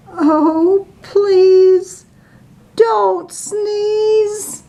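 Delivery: high, begging plea; high pleading bees. Acted. Bees plead with the elephant, high and begging.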